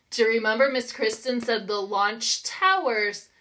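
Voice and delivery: normal talking voice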